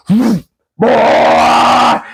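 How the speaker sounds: put-on booming high bark of a menacing old man; creepy old man yell. Tall man shouting at the fleeing boy, menacing bark. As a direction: call the boy like a sinister undertaker. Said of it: "the old man goes".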